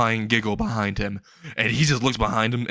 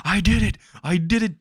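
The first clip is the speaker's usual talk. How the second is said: high, louder gleeful cheer; pitched-up burst of triumph; excited kid; 9 semitones higher, 3 dB louder than usual. Retold or imitated. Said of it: "jumping up and down like an energetic kid".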